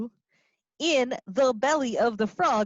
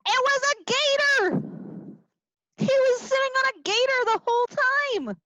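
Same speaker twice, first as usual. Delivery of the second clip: big reveal; 14 semitones higher, faster than usual. Acted. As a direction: reveal it with a thrill.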